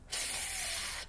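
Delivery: f as a long voiceless hiss; f sound. Shown as a demonstration. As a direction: teeth on the lip, blow f. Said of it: "Let's try that".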